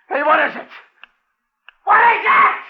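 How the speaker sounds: screaming high and loud; terrified shout. Acted. Stranded man screams at creature in brush. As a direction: yell it out in panic. Yelling at hidden thing.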